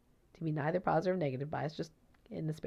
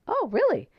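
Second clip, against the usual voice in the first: surprised; 12 semitones higher, 8 dB louder than usual. Retold or imitated. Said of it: "I said".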